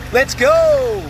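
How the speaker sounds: high, loud hype call; excited shout. Real, not acted. Plumber hyping viewers as the jetter starts, loud and high. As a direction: fire up the jetter with a yell.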